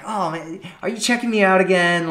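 flirty mock accusation; playful flirty. Shown as a demonstration. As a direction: tease him as if caught again. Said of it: "fun, kind of flirty kind of way".

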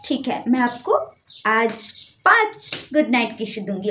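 soft, slow cooing apology; soft baby talk. Acted. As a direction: coo it slowly to your sweetheart. Babying boyfriend, apologizing softly.

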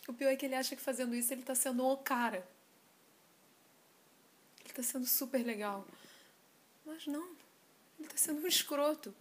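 desabafo irritado, com ênfases mais altas; irritação. Acted. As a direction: reclame do ex com indignação contida. (irritado, desabafando)

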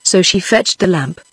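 ordinary delivery, nothing marked

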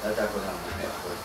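low, soft, gentle voice; calm forgiveness. Acted. Mohammad gently forgiving his friend, soft and low. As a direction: reassure him quietly that all is forgiven.

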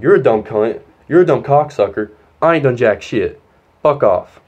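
loud retold cursing, hitting each insult; fed up, punched-out swearing; aggravated outburst. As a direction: re-enact losing your temper at the principals. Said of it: "I was aggravated".

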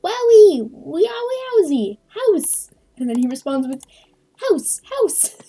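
high, loud squealing wowie; excited squeal. Acted. Player and puppet gushing about a house, squealing. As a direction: shriek it with delight. Reading excited game messages.

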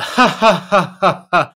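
loud, high forced cackle; exaggerated fake laughing back at the customer; mock laugh. Salesman fakes laughing along with a stalling customer, hammed-up. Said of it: "I'd be like".

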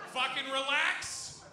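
loud, drawn-out telling-off; loud scolding. Real, not acted. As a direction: tell the crowd off loudly to calm them. Comic calming a shocked audience.

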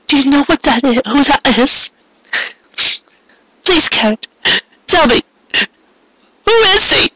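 high, desperate questions rising; frantic pleading. A narrator's reading. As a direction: beg him for the truth. Cupcake frantically questions Garrett.

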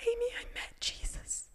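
high, hushed, choked-up whisper; retold tearfulness. Friend tells Amy she met Jesus, tearful whisper. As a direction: imitate her overcome, barely voiced. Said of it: "she starts getting tears in her eyes".

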